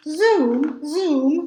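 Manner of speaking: usual speaking voice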